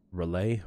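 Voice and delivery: "Relay" said as ruh-LAY, ending questioningly; trying a pronunciation. Shown as a demonstration. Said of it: "Everybody pronounces it differently".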